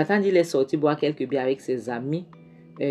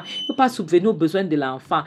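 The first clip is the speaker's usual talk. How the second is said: ordinary delivery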